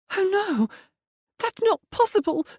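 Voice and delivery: high, shocked cry of disbelief; shocked gasp. A narrator's reading. Dorothea hearing of the death, in high shocked disbelief. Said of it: "Dorothea gave a gasp".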